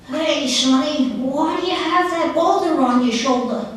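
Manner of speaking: a hint of a creaky granny calling out to a young man; old woman voice. Speaker voicing the roadside old woman questioning the traveler, lightly voiced old woman.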